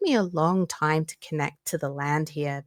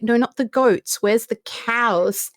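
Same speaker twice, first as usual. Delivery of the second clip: raised, louder correction with drawn-out question; exasperated insistence; 6 semitones higher, 4 dB louder, slower than usual. Retold or imitated. Tourist correcting a confused guide about cows, exasperated.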